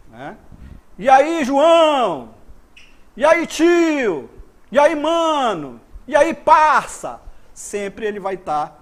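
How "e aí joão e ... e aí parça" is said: loud, high, drawn-out hail; calling someone. Shown as a demonstration. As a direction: call out the name slowly and loudly. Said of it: "Quando você chama alguém". (chamando alguém em voz alta, arrastado)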